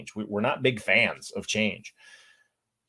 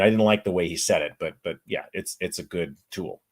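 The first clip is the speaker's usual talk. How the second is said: usual speaking voice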